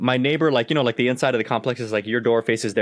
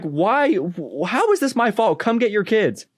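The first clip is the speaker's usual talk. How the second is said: high-pitched, incredulous retelling; indignant protest; 8 semitones higher, slower than usual. Retold or imitated. Dog owner retells protesting to the mom, high incredulous indignation. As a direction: act out refusing the blame.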